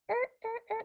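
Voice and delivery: high squeaky chirping; cricket chirps. Pretend. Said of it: "That's my cricket impression".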